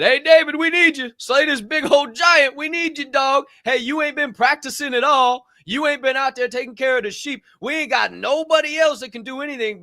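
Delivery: high, loud pleading voice; army begging David to fight; pleading soldiers. Retold or imitated. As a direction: beg the shepherd boy for help. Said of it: "they just called upon him and said".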